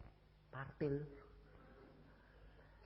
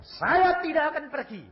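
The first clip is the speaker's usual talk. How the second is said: loud, high declaration of refusal; defiant shout; 12 semitones higher, 10 dB louder than usual. Retold or imitated. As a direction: cry it out in defiance. Retells defiant refusal to police. (seruan lantang menantang)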